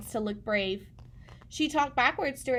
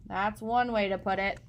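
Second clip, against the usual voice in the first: father's voice; 2 semitones lower than usual. Acted. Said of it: "said Chester's father".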